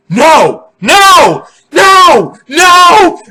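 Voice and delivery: escalating high panicked yells of "No!"; panicking no. Retold or imitated. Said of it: "Like, panicking".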